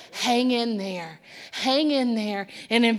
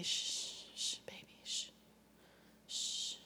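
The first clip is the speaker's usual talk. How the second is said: whispered hushing; breathy shh to calm; soothing a child; 23 dB quieter than usual. Acted. Jesus hushing the storm.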